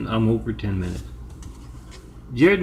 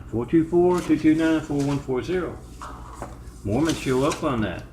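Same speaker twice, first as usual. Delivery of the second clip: normal talking voice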